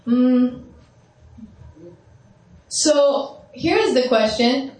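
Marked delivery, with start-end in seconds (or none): none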